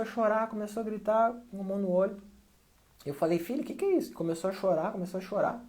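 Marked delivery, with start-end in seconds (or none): 3.4-4.1 alarmed father. Retold or imitated. Father alarmed at crying son.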